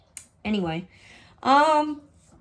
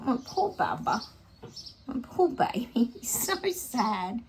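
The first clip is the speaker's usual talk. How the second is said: pitying pet talk; 4 semitones higher than usual. Real, not acted. Owner pitying her tired, sad dog, tender cooing. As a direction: coo sorry for the poor dog.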